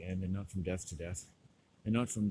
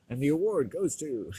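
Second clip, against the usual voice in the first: higher, louder presenter's build-up; mock award announcer; 4 semitones higher, 6 dB louder than usual. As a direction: announce a winner with hammed-up ceremony.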